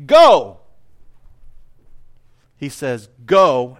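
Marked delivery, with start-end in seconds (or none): 0.1-0.6 loud, high sudden shout; commander's order. Acted. Preacher imitates a commander sending troops off, shouted order. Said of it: "the kind of commander that says". 3.3-3.8 firm, loud call, pitched down without screaming; leader's go. Acted. Preacher voices Jesus leading the way, firm go. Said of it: "and he leads the way".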